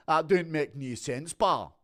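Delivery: emphatic Scottish, rising on "pal"; Scottish accent. Shown as a demonstration. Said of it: "a Scottish accent's a bit more like that".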